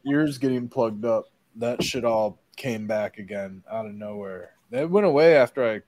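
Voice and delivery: usual speaking voice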